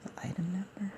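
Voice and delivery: breathy whisper to himself. Real, not acted. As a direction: whisper it under your breath, puzzled. Muttering to himself on hold.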